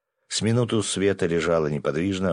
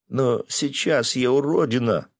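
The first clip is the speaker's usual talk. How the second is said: put-on high, slow, distressed girl's voice; self-pitying lament; 8 semitones higher, 3 dB louder, slower than usual. Girl upset over her bald head, slow high lament. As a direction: lament it slowly, pitched high.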